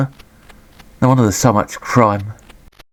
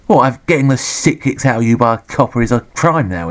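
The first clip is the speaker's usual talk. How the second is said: ordinary delivery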